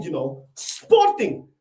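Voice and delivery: "Sporting" dragged out low and slow; low slow scorn on "Sporting"; deep mocking drawl. Mocking the rival club's name.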